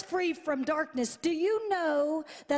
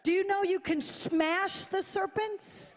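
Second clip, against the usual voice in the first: ordinary talk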